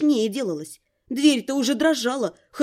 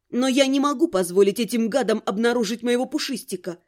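ordinary delivery

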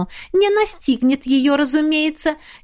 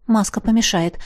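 ordinary delivery, nothing marked

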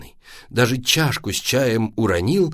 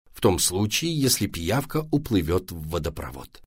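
plain reading voice

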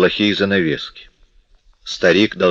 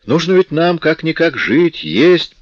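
high, louder mocking retort; 10 semitones higher, 4 dB louder than usual. A narrator's reading. Daughter fed up with her father, mocking. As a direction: throw it back in a raised, taunting voice.

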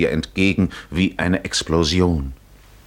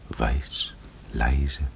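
whisper; 5 semitones lower, 5 dB quieter than usual. Acted. Dreamy snowy memory.

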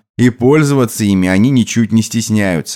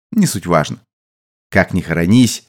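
plain reading voice

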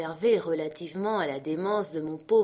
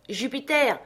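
high, raised call; 6 semitones higher, 4 dB louder than usual. A narrator's reading. Master ignores his friend and calls Jupiter, crying out. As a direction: shout up to someone in a tree. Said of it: "cria-t-il sans m'écouter".

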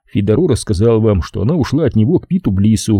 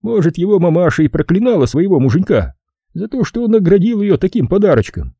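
much higher character voice; Fowler the boss, reproachful; 9 semitones higher than usual. Acted. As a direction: lay down the law, pitched well up. (высокий голос босса, с упрёком)

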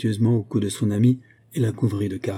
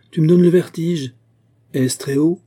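loud, high-pitched exclamation; crying out; 8 semitones higher, 8 dB louder than usual. A narrator's reading. Olga dizzy watching her friend at the edge, crying out. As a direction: call across in alarm, ending on a question. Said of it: "lui cria Olga".

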